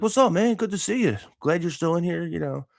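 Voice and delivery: high, cheery imitation of a gym buddy; upbeat high-pitched retold chatter; happy greeting. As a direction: imitate a happy guy saying hello. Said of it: "he's all happy".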